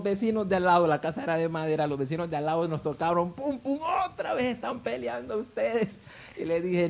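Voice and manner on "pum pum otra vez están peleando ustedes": high, strained mock yell; neighbours calling out. Neighbours banging on the wooden wall, high mock yell. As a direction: imitate a complaint called through a wall, pitched well up.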